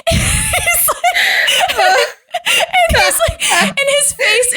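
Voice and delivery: laughing hard through the words; high squealing laugh mid-sentence; cracking up while telling it. Real, not acted.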